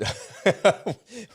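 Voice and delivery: high helpless laugh; exasperated laugh. Retold or imitated. Retelling the contractor's reaction, a high exasperated laugh. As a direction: laugh it off in disbelief.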